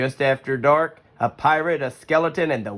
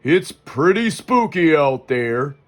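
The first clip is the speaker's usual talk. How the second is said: a bit higher, slower, lightly voiced worried father; Papa Bear voice; 5 semitones higher, slower than usual. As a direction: play the uneasy dad.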